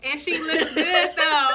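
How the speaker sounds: loud, high, laughing praise; delighted, laughing cheer; laughing compliment. Real, not acted. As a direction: hype your friend up through a laugh. Friend cheers her bald look.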